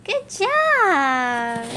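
praising the viewer for counting, bright cheer; praise. Acted. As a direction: cheer the kids on.

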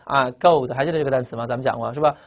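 ordinary talk

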